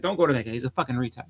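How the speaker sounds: normal talking voice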